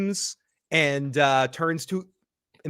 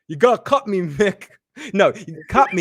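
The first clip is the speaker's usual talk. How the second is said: higher, louder desperate plea to be cut; pleading shout; 4 semitones higher, 6 dB louder than usual. Retold or imitated. Kenny begging Mick to cut him, desperate shout. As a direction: shout it like a man begging for the worms. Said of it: "and Kenny's like".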